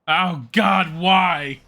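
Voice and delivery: loud, high despairing cry; anguished cry. Real, not acted. Player dying horribly in the game, loud despairing cry. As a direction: wail it at the sky.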